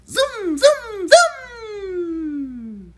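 loud, high zoom zoom zoom; zooming sound. Acted. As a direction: whoosh past like a speeding fox. Fox rushing past.

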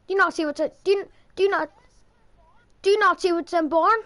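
stumbling exasperated repeat; exasperated insisting. Real, not acted. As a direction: push the question at him, half shouting. Insisting at teammate.

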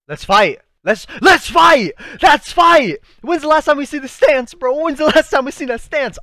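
high, loud fan frenzy; hyped-up yelling. Real, not acted. Fan spotting the hero's old fighting stance, excited yelling. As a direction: yell it out in pure excitement.

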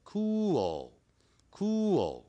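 "cool" high, falling and stretched into "koo-ol"; correct pronunciation. Shown as a demonstration. Teacher correcting students after a mistake, careful pronunciation. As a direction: say "cool" slowly, ending on a dark L. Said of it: "可不要念成".

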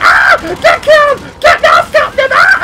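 very high, loud screamed gamer imitation; mock screaming. Gamer imitating someone screaming every five seconds, shrill mock scream. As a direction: shriek it like an overexcited player on the mic. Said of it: "if you just start screaming".

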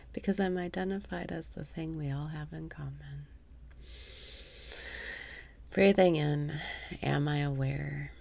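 unhurried meditation voice with whispered asides; guided meditation. Acted. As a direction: lead the listener inward gently. Leads awareness meditation.

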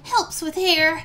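usual speaking voice

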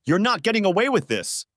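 high-pitched, contained anger; spat out. A narrator's reading. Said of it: "he spat".

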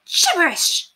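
high, imperious interruption; haughty lady, dismissive. A narrator's reading. Lady interrupting the governess's explanation, haughtily dismissive. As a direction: cut in grandly, high-pitched. Narrator plays Lady Constance.

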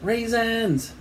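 high-pitched mock shout; storyteller's contained boyish yell; boy's shout. A narrator's reading. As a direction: call out high without real volume. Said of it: "shouted Eric".

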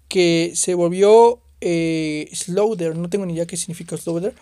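ordinary talk

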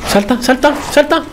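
high, tense repeated command; urgent pleading. Real, not acted. Player to character during escape, urgent and high. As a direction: urge the jump in a squeaky panic. Urging character to jump away.

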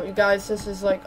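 ordinary talk

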